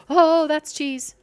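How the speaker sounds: ordinary talk, nothing marked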